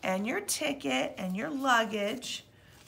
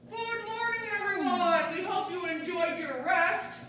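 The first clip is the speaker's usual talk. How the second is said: higher and louder, a bright captain's good morning; cheery wake-up announcement; 6 semitones higher, 4 dB louder than usual. Pretend. Pilot greets waking passengers before landing, higher and louder. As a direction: wake the cabin up with a sing-song announcement.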